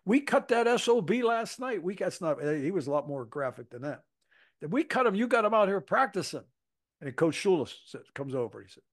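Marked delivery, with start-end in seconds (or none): 0.1-2.4 retold angry yelling. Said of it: "comes screaming at me". 4.6-6.4 retold angry yelling. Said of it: "comes screaming at me".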